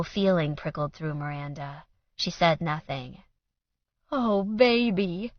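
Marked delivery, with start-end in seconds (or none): 4.1-5.3 slow, higher, sad murmur. A narrator's reading. Mother pitying daughter, slow and sad. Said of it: "in a sad, sad voice".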